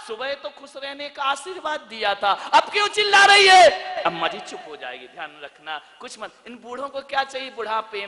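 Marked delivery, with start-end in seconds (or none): 2.3-3.7 mock shout. Mock scolding of mother-in-law.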